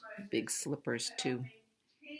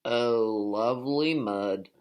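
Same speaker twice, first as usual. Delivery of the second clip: louder pig voice, a touch lower; 3 semitones lower, 7 dB louder, slower than usual. A narrator's reading. Teacher voicing the pig for the class, lightly lower and pleased. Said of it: "said the pig".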